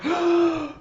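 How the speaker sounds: sharp high-pitched gasp; mock shocked intake of breath; startled gasp. Child locks eyes with the dog, startled gasp.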